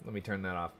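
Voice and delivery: normal talking voice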